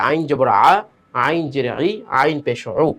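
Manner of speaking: গলা থেকে দ্রুত আইন; throat-voiced ain. Shown as a demonstration. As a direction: recite আইন জবর আ from the throat without drawing out. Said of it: "গলার ভিতর থেকে উচ্চারণ এবং সেটা তাড়াতাড়ি".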